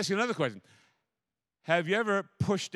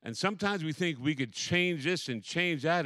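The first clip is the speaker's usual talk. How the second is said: ordinary delivery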